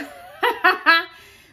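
a loud, high burst of laughter; breathless laugh. Real, not acted. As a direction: laugh it off, winded.

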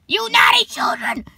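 put-on high, harsh shriek at naughty children; yelled scolding. A narrator's reading. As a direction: shriek it like an angry witch. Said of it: "she yelled at them".

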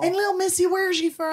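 high, quick sing-song southern lady; southern grocery lady. Retold or imitated. Mimicking the grocery store lady asking where he's from, in a high southern lilt. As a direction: chirp it like a nosy southern store clerk.

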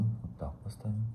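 hushed whisper to self. Real, not acted. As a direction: whisper it under breath. Whisper while setting up.

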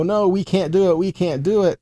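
normal talking voice

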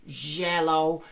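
yellow said with a J sound, like jello. Shown as a demonstration. Teacher shows how yellow turns into jello. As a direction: buzz the start of yellow like a J. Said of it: "me llevaría a pronunciar esta palabra".

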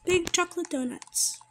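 normal talking voice

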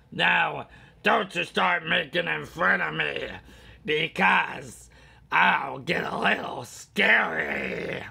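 loud, menacing cartoon villain; the Joker. Warning viewers he gets scary, loud Joker voice. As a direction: threaten playfully as a manic villain. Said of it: "Now I really sound like the Joker".

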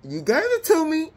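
high, teasing mock taunt; playfully acted gloating laugh; gloating queen. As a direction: taunt them in a high sing-out. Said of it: "look, the queen like".

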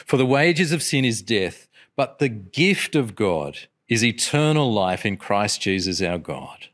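usual speaking voice

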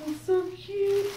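high cooing over something cute. Real, not acted. Cooing over tiny clothes.

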